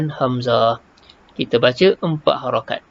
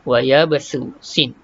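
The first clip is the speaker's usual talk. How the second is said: ordinary delivery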